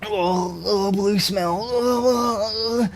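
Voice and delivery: high, slow swooning oh; hammed-up dreamy sighs; mock swoon. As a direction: coo over the smell. Reacting to chat about smell.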